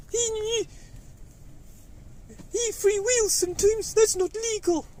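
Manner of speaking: very high squeaky puppet, telling tales. Pretend. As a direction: tattle like a tiny puppet. Puppet voice chimes in.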